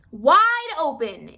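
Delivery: loud, slow yell. A narrator's reading. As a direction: call it out firmly. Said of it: "yelled his wife".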